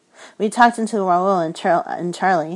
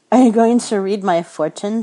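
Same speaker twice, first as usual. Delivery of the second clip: higher, louder, half-teasing question; 4 semitones higher, 8 dB louder than usual. Acted. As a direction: ask it half in fun. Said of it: "only half teasing".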